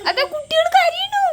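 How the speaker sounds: high, whimpering pretend baby cry; playful high mock sob for the child's game. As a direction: wail like a doll baby crying in play. Pretend play, babies crying.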